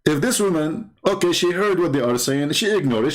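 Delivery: usual speaking voice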